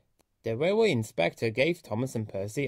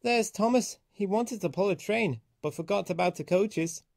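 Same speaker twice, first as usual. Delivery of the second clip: higher, mocking singsong; teasing; 6 semitones higher than usual. A narrator's reading. Other engines tease Thomas, mocking. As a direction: poke fun at him. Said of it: "engines laughed at Thomas".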